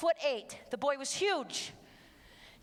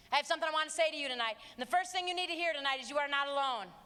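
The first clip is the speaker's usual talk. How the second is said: usual speaking voice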